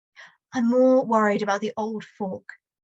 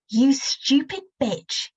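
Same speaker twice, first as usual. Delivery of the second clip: slow, menacing insult growing louder; father's rising anger; 4 semitones higher, slower than usual. Acted. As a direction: spit it out slowly, building. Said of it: "voice getting louder".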